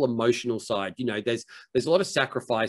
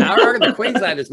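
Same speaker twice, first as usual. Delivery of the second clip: laughing, higher and louder, quick; amused teasing; 9 semitones higher, 8 dB louder, faster than usual. Real, not acted. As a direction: tease them through a real laugh. Joking about Queenslanders' accent.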